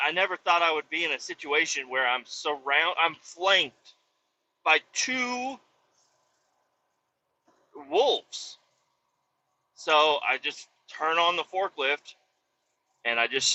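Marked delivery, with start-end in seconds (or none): none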